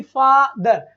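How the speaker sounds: फादर with a long open aa; word demo. Shown as a demonstration. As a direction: model फादर clearly. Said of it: "किस type की". (फादर लंबे आ के साथ)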